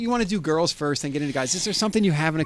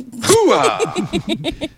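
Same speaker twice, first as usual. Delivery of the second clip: triumphant whoop; 9 semitones higher, 3 dB louder than usual. Real, not acted.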